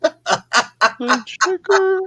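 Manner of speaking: laughing. Acted. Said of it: "laugh heard".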